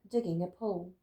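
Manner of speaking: plain reading voice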